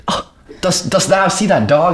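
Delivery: put-on high, theatrical old-English voice; mock medieval accent. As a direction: play a hammed-up medieval lady spotting a dog. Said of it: "she goes".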